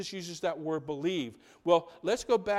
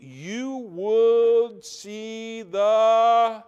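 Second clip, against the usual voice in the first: very slow, louder, each word stretched; drawn-out cue; 3 semitones higher, 7 dB louder, much slower than usual. Real, not acted. Preacher cues the congregation to finish the verse, drawn out. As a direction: stretch it out and leave it hanging. Leaves verse hanging for audience.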